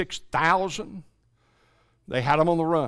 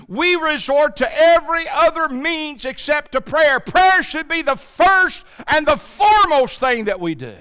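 raised, driving sermon voice; fervent preaching; 9 semitones higher, 7 dB louder than usual. Acted. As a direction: press the congregation hard, louder line by line. Preaching to congregation.

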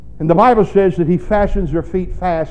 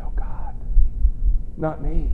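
hushed dread; 5 dB quieter than usual. Pretend. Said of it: "You say".

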